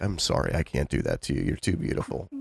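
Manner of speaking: low, soft, sweet refusal; Henry Cavill. Retold or imitated. Imitating the actor declining dirty talk, soft and low. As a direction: imitate a gentle man politely letting someone down. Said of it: "when they ask him to, he's like".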